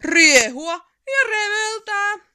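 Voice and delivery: high, loud, slow gleeful cry; wild troll whoop. A narrator's reading. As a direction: shout for joy at the mischief. Troll rampaging in town. (riehakas peikon huuto)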